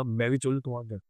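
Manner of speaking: spooky heavy voice imitated while telling a ghost story; heavy eerie voice. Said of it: "ऐसी भारी आवाज में रात को ढाई बजे बोला".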